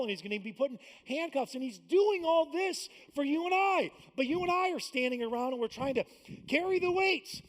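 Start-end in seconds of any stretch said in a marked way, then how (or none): 1.5-7.4 loud, high sermon peak; fiery preaching. Real, not acted. Pastor preaching hard to the congregation, loud and high. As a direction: preach it out with rising fire.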